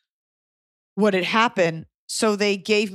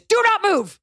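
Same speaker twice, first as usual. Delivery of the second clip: sharp loud shout, much higher; shouted order to stop moving; yelling; 12 semitones higher, 4 dB louder than usual. Retold or imitated. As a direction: yell a warning to freeze. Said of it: "Like, yelling at her".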